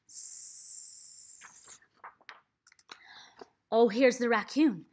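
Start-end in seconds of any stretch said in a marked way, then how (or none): 0.1-1.8 soft unvoiced hissing "S"; snake hiss. Shown as a demonstration.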